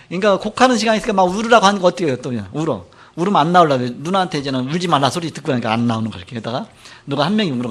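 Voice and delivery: normal talking voice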